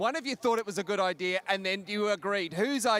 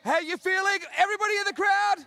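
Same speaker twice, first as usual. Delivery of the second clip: high, loud call to the whole crowd; crowd hype shout; 9 semitones higher, 4 dB louder than usual. Real, not acted. Host calls out to the waking crowd, loud hype shout. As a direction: rev up the stadium, shouting.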